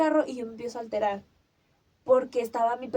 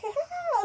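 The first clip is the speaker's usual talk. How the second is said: retold scream of pain. Owner crying over her dog, high imitated scream of pain. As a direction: imitate a grief-stricken cry, shooting up in pitch. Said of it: "solo de dolor".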